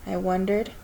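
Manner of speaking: ordinary delivery, nothing marked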